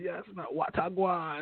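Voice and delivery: quick mock Jamaican patois reply; Jamaican accent. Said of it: "They be like".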